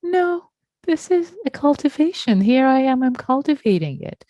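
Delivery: mildly voiced determined meditator; earnest inner voice. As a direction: act out someone defending their practice.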